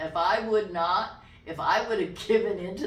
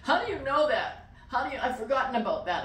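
higher, mock-tearful questioning; pitched-up, overcome exclamation, lightly acted; imitated crying disbelief; 6 semitones higher, 3 dB louder, faster than usual. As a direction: imitate a man tearfully astonished. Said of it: "crying. He's like".

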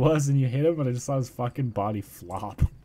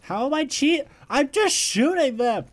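high, indignant protest; 12 semitones higher than usual. Real, not acted. Accused of cheating.

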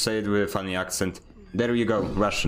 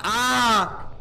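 sudden high yelp of fright; startled yelp; 12 semitones higher, 4 dB louder than usual. Real, not acted. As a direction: jump at a scare with a loud "ah". Startled by something in game.

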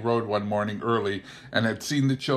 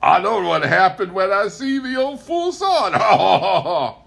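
high, loud laughing crow; crowing delightedly; 10 semitones higher, 9 dB louder than usual. A narrator's reading. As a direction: crow with glee. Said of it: "Elwin was crowing delightedly".

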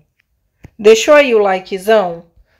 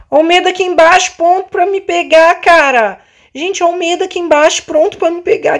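excited; 6 semitones higher, 4 dB louder, faster than usual. Real, not acted. Fear chasing in game.

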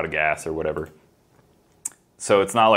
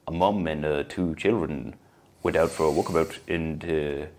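badly faked New Zealand newsreader; soothing, low mock-Kiwi headline reading; attempted Kiwi accent; 3 semitones lower, 5 dB quieter than usual. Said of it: "that's not a Kiwi voice".

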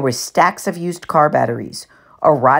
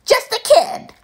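much higher, sneering mimic; mocking falsetto; 3 dB louder, slower than usual. A narrator's reading. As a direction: parrot his friend in a squeaky voice. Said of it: "mimicked in a falsetto voice".

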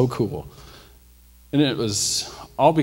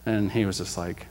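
ordinary delivery

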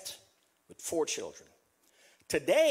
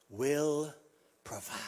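lower, breathy whisper on provide; whispered refrain; 5 semitones lower, 4 dB quieter, slower than usual. Acted. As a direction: let provide fall away into a whisper.

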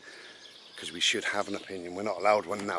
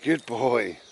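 praising the dog. Real, not acted.

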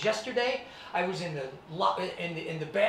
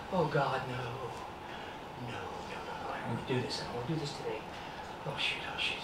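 much softer, sinking "no, no, no" ending in a whisper; dreading whisper; 6 semitones lower, 10 dB quieter than usual. Retold or imitated. Boy spotting his bully in the locker room, whispering in dread. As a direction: shrink away and plead under your breath.

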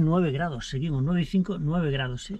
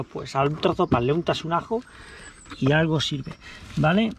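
ordinary talk, nothing marked

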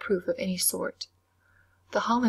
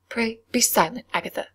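a firm, raised rebuke; louder severe command to a giggling girl; severely; 4 semitones higher, 4 dB louder than usual. A narrator's reading. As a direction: cut her off with a stern word. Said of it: "said Miss Wilson severely".

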